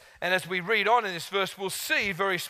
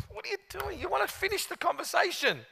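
mock inner alarm; 12 semitones higher, 4 dB quieter than usual. Quoting himself, inner voice.

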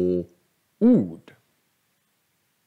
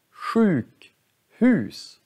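Sjukhus as two words, both long; two stressed syllables; 5 semitones higher than usual. Shown as a demonstration. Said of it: "När vi pratar, då, då säger vi två ord".

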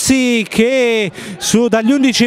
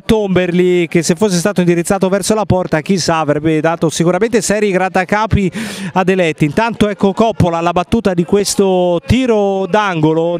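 ordinary talk

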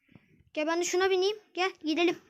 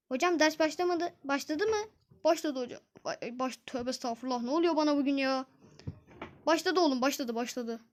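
ordinary talk, nothing marked

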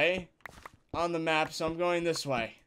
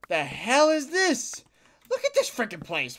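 surprised; 7 semitones higher, 4 dB louder than usual. Real, not acted. Stumbles into strange cavern.

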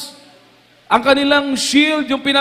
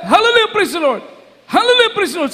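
high mock battle shout of praise; retold shouting; 7 semitones higher, faster than usual. Preacher echoing the soldiers shouting praise, high. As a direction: cry it out like soldiers under fire. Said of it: "sigaw sila".

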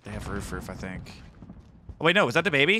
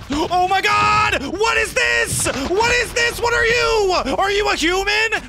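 very high, loud disbelief; real high-pitched shouted outburst; incredulous screaming; 14 semitones higher, 4 dB louder, slower than usual. Real, not acted. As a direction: shriek questions at the opponent. Outraged at a suspicious opponent.

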